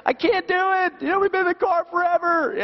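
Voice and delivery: high, hammed-up backseat whine; complaining kid. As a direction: whine like a kid stuck on a road trip. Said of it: "they're complaining in the back seat".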